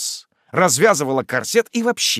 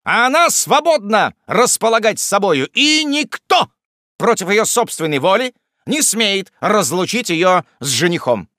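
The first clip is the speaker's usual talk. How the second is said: loud, high, emphatic proclamation; defiant declaration; 7 semitones higher, 5 dB louder than usual. A narrator's reading. Jingle defends the lady's freedom, defiant. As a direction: declare it grandly, peaking midway.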